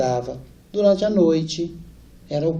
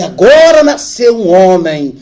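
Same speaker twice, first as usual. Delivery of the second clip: louder, puffed-up proud father voice; proud macho father; 6 semitones higher, 9 dB louder than usual. Retold or imitated. Son imitating his father's imagined boast, proud and loud. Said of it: "o senhor deve ter pensado assim".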